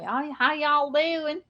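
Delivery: slow, drawn-out Tennessee drawl imitation; playful Tennessee accent impression. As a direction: stretch out "How, how y'all doing?" in a Southern Tennessee drawl. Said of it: "in Tennessee".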